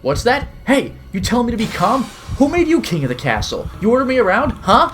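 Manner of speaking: high, loud enraged shout; a stranger screaming back in fury; furious yelling. Acted. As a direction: explode at being told to calm down. Man flaring up at calming attempt.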